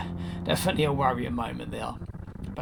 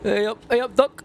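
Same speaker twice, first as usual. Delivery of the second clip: broad Yorkshire twang, pitched up; mock northern accent; 11 semitones higher, 9 dB louder than usual. Teasing a mate who drinks Tetley, in a mock northern accent. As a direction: ham up a northern voice.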